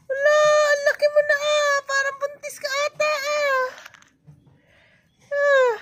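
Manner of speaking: high, teasing pet-talk voice; playful fussing. Real, not acted. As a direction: fuss over a little one in a high voice. Fussing over bebe's size.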